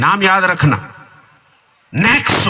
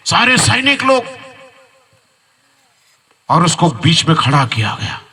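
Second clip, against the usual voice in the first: raised, fervent preaching voice; preaching story; 6 semitones higher than usual. Acted. Preacher to congregation, raised fervent storytelling. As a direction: tell it to the congregation with rising fervour.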